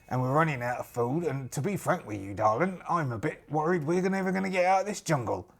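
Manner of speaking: colloquial, worried pirate voice; lost pirate captain. Acted. Pirate confiding in a stranger about being lost, worried role voice. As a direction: play a weary pirate owning up to being lost.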